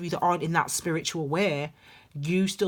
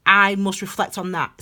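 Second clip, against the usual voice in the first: ordinary talk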